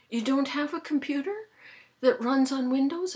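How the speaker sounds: high, loud disbelieving question; incredulous. Retold or imitated. Phone caller checking about the computer, incredulous.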